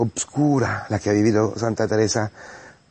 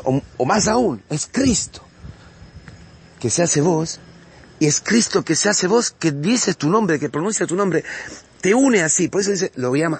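fervent preaching; 6 semitones higher than usual. Acted. Preacher proclaiming Christ's victory to the congregation, fervent preaching. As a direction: preach it with mounting passion to the faithful.

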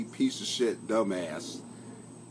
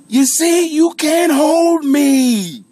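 very loud, high shout with a long held final word; hammed-up defiant yell; 15 dB louder, much slower than usual. Streamer bursting out of the box at viewers, hammed-up yell. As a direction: blast it out and hold the last note. Climax of comic rant, bellowed.

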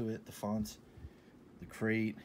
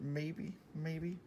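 teasing maybe; 7 semitones higher, 4 dB quieter than usual. Real, not acted. Teasing doubt about wearing it.

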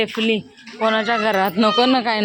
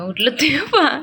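usual speaking voice

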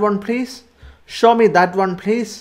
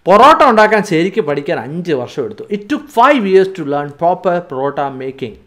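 ordinary delivery

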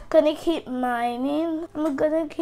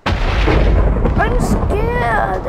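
scared; 8 semitones higher, 7 dB louder than usual. Real, not acted. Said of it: "I'm actual scared".

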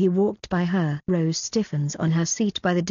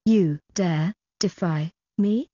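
ordinary delivery

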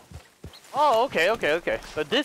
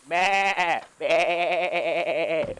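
sheep bleat; 3 semitones lower, much slower than usual. Pretend. Imitating sheep.

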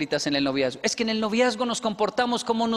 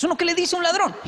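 incredulous outburst; 10 semitones higher, 5 dB louder, faster than usual. Real, not acted. Exasperated rhetorical question.

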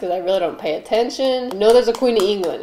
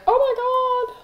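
much higher squealing fan voice; gushing fans. Retold or imitated. Fans begging her to keep cooking, high squeal. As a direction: gush like a starstruck fan. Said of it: "And they'll be like".

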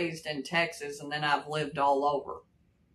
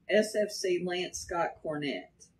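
usual speaking voice

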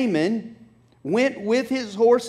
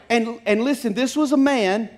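ordinary delivery

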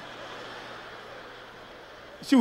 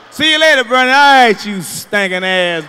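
shouted goodbye souring into an insult; loud mock send-off; 12 dB louder than usual. Acted insulting goodbye to the woman.

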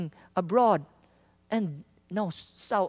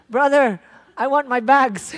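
louder call to get his attention; 6 semitones higher, 9 dB louder than usual. Retold or imitated. Preacher calls to the dazed driver for his bags, louder. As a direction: raise the voice to reach the stunned driver.

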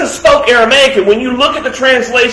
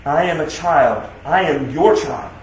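humble prayer; 6 semitones lower, 5 dB quieter than usual. Retold or imitated. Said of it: "you're saying".

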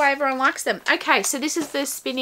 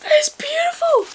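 very high, rapturous squeal; squealed praise; 4 dB louder than usual. Real, not acted. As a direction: squeal it, delighted. Overwhelmed by the tote.

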